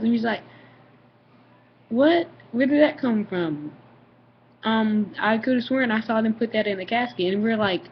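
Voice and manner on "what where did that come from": loud high "what", then slower question; taken aback, then puzzling it out; mock startled dad; retold bewilderment.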